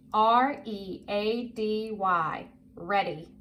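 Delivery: slow, letter by letter, then the word; measured spelling drill, each letter apart. Shown as a demonstration. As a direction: spell it slowly for a young reader. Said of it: "I'm gonna spell it and say it".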